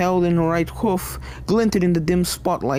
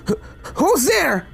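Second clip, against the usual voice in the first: higher, slow, stuttering fright; frightened stammer; 11 semitones higher than usual. Acted. Starlight hearing something move past her, high and scared. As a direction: stammer as panic creeps in.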